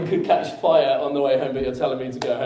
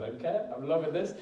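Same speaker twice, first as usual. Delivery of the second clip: gleeful aside; 8 dB quieter than usual. Retold or imitated. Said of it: "I was like".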